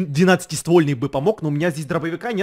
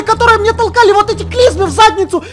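yelling; 12 dB louder than usual. Acted. Zombie attack, yelling at zombies.

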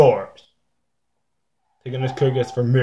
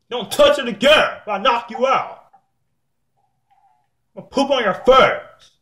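high-pitched, heated threat; angry threat; 9 semitones higher than usual. Real, not acted. Player warning someone off their stuff, high angry threat.

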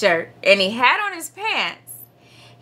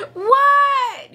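very high, long-drawn holler; high-pitched, stretched-out kid's yell; yelling back; 13 semitones higher than usual. A narrator's reading. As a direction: yell back to a calling mother. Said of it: "yelled Froggy".

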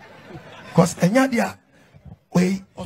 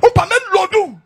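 laughs while calling out higher; loud laughing; 8 semitones higher, 7 dB louder than usual. Real, not acted. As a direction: laugh the words out.

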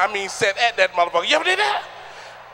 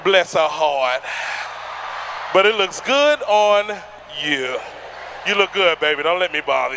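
slow, lower mock kindness; sarcastic sweetness; 6 semitones lower, slower than usual. Real, not acted. Comedian teasing a woman's outfit with slow fake praise. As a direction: drawl a fake compliment.

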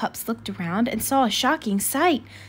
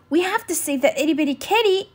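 gushing; 6 semitones higher than usual. Acted. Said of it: "exclaimed Rubble".

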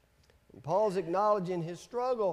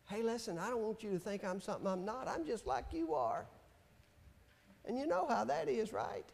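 softer, quick confession to the listeners; confiding aside; 10 dB quieter, faster than usual. Real, not acted. Preacher confessing to congregation, soft and fast.

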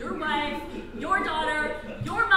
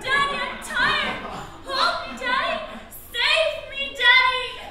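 high, pleading little daughter; crying child; 8 semitones higher, 3 dB louder, slower than usual. Pretend. Acting daughter Nancy begging Daddy to save her, crying. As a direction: play a child crying out to be saved. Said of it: "She cries".

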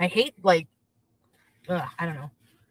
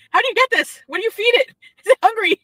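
high, louder playful voice asking about the hump; mock curious child; 11 semitones higher, 7 dB louder than usual. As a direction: ham up a nosy kid questioning the mom.

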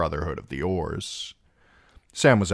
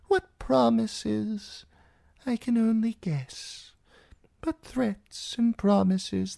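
put-on old maester's voice. A narrator's reading. Old maester gently explaining grief to Sam, frail old voice. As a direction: speak as the ancient blind maester, gently.

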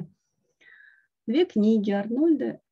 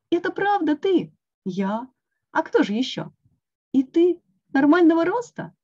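amazed; 7 semitones higher, 5 dB louder than usual. A narrator's reading. Toad amazed friend is back.

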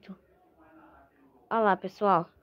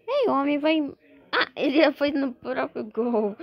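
usual speaking voice

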